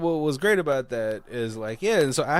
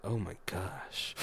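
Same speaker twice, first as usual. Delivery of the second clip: slow, much softer hushed gasp; 5 semitones lower, 12 dB quieter, slower than usual. Retold or imitated. Knights listening in at the door, hushed. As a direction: breathe it like an eavesdropper.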